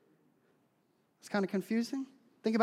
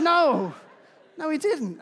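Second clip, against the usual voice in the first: firm no; 8 semitones higher, 10 dB louder, slower than usual. Retold or imitated. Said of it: "I was like".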